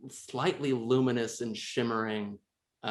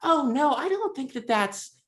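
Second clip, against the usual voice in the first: high alarmed cut-off; high panicked voice; 3 dB louder than usual. Acted. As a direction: squeak out a quick protest. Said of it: "cuts her off and goes".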